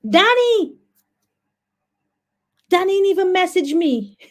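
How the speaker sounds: high-pitched, drawn-out dismay; shocked realisation. Real, not acted. Host realises she forgot Danny's link, high shocked wail. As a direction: cry out as the blunder dawns on you.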